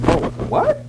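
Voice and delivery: squeaky high startled what; shocked surprise. Retold or imitated. Preacher hearing his friend believes in God, high shocked surprise. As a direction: react as if floored. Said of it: "I'm like".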